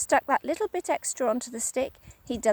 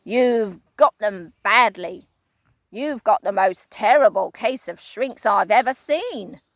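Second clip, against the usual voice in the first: Mister Twit crying; 6 dB louder than usual. Acted. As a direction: point the words like a pistol. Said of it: "cried Mister Twit".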